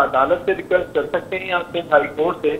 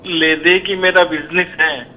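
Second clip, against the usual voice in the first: poem recitation; 4 dB louder than usual. Acted. Recites a satirical couplet.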